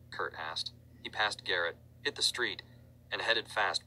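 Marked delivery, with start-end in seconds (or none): none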